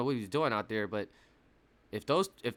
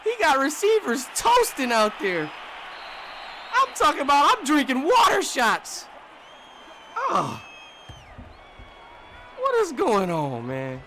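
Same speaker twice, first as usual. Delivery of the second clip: lively yelling; 11 semitones higher, 6 dB louder than usual. Real, not acted. Ranting as defense collapses.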